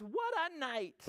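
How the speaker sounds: high-pitched little mole voice; exclaiming mole. Acted. Mole arriving out of the storm, high-pitched exclamation. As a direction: exclaim as a small storybook mole. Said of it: "Mole mutters".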